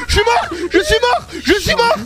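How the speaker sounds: very high, repeated panic shout; real shriek of gamer panic; panicked screaming. Real, not acted. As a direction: scream it in sudden fright, over and over. Jump scare, character dies.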